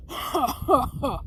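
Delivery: breathy, put-on ogre guffaw; ogre laugh. Ogre gloats after slapping Deku into a wall, mock laugh. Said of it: "the ogre just slaps him into a wall".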